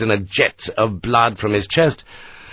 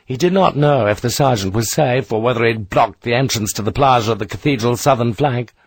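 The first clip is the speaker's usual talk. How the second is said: plain reading voice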